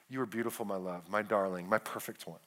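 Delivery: very low, soft words to a beloved; hushed, intimate declaration of love; tender husband. Acted. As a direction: murmur it lovingly to your wife. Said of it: "gotta learn how to talk like that".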